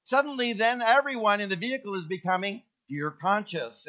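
normal talking voice

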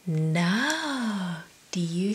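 whispered no; soft secret whisper. Real, not acted. Aside to kids.

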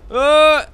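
sudden loud high cry; startled yell. Real, not acted. Gamer startled during the quake, loud yelp. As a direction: yelp in shock.

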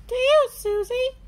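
put-on high, loud child cheer; cheering Peppa Pig. A narrator's reading. Peppa calls to Susie during tennis, cheering high. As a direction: call the ball across cheerfully. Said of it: "cheers Peppa".

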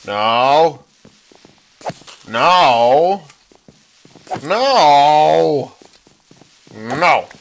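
loud drawn-out refusal at the creeper; mock yelling no.

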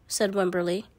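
plain reading voice

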